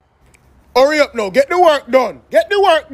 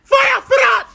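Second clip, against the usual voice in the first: scolding shout; 8 semitones higher, 3 dB louder than usual. Acted. Uncle scolds nephew on phone.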